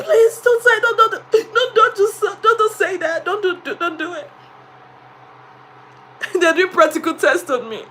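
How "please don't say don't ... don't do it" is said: high, laughing pleading; laughing plea. Retold or imitated. Teacher pleading with the children, laughing. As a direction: beg them to stop while laughing. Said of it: "I said".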